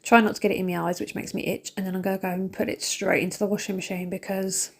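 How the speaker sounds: normal talking voice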